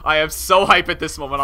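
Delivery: ordinary talk, nothing marked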